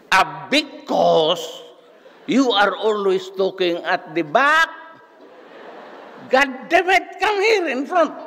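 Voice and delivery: high shrill scolding, slow at start; imitating angry teacher; shrill old professor. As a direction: bark at the student in a shrill high voice. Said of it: "boses, ano, siya, shrill".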